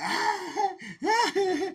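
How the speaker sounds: very high, loud cackle; imitated hard laughter. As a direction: mimic someone laughing hardest, high-pitched. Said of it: "he laughing the hardest".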